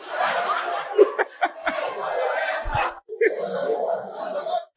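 high, wheezy burst of laughter; long laugh. Real, not acted. Preacher after warning about swallowing bones, laughing hard.

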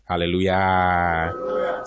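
usual speaking voice

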